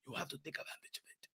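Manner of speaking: low, fast, voiceless whisper. Acted. Urging the fighter to take his chance, in a fast whisper. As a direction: hiss the advice like a corner coach.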